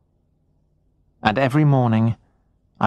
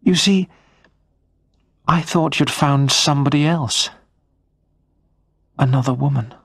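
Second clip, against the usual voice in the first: a touch of seriousness in the wife's higher voice; serious voice; 4 semitones higher than usual. A narrator's reading. Wife admitting she feared another woman, mildly serious. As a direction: confess the fear plainly. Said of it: "continued in a serious voice".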